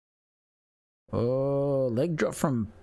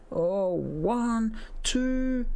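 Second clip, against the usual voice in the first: excited count along; 13 semitones higher, much slower than usual. Real, not acted. Fan counting along with the near fall, excited. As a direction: count the pin breathlessly.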